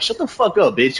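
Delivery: high-pitched mock snap at a bragging coworker; retold snap at a girl bragging about him; mock telling off. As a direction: fire it back, mock outraged.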